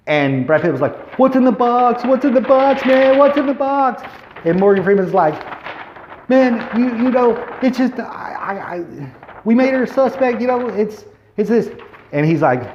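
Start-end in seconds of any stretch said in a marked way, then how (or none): 1.2-4.0 high, loud, desperate repeated demand; panicked shout, much higher; frantic yelling. Retold or imitated. Brad Pitt demanding, retold climax. 6.3-11.7 high, halting, searching for words; nervous high-pitched fumbling; flustered stammer. Retold or imitated. Morgan Freeman stammering under pressure.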